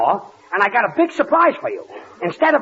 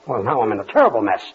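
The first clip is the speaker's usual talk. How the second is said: ordinary talk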